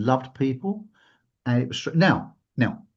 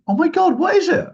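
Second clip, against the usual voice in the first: high, loud, gushing imitation of the friend; excited squeal; 7 dB louder than usual. The friend gasping over a present, high and excited. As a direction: mimic her thrilled gasp at the gift. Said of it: "She went".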